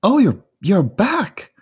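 very high, loud, delighted surprise; high-pitched character greeting returning friends; surprised Gundis. Acted.

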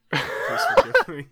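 hammed-up begging falsetto; mock pleading wail. Cohost begging forgiveness in a squeaky falsetto. Mock plea after a bad intro.

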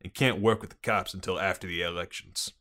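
put-on police chief briefing in a Boston accent; gruff-cop Bostonian, dropped R's. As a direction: lay out the case in Boston cop talk. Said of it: "Excellent Bostonian accent".

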